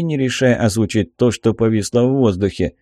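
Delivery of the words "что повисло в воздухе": ordinary delivery, nothing marked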